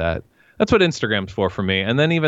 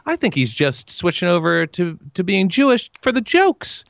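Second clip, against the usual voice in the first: high, rising Jerry Seinfeld voice; Seinfeld-style whiny delivery; Jerry impression; 9 semitones higher than usual. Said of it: "pretty good impression of Jerry".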